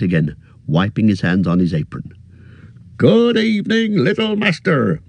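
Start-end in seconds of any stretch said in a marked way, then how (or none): 3.0-5.0 much higher, bustling landlord voice; a fussy, friendly innkeeper bending down; cheery innkeeper. A narrator's reading. Innkeeper greets the hobbit, high and bustling.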